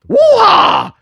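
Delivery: high, loud mock "woo-ha" holler; imitated rapper's yell. Host mimics the rapper's terrible onstage chant, imitated yell. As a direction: belt out an imitation of a stage chant. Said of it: "I want you to say it with me".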